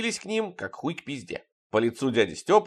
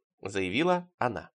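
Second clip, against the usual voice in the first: usual speaking voice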